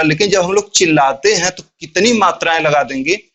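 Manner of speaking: usual speaking voice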